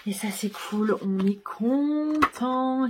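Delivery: slow, high, beaming delight. Real, not acted. Booktuber tells viewers she's thrilled, drawn out and high. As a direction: savour the happy news, drawn out. Delighted about the book's dedication.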